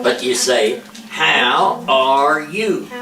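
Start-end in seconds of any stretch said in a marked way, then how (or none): 1.1-2.9 "How are you?" drawn out very slowly, lips kept still; slow ventriloquist drill. Shown as a demonstration. Teacher models the right way for the child, slow and still-lipped. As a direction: stretch each word of "How are you?" without moving the lips. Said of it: "Twenty-five times".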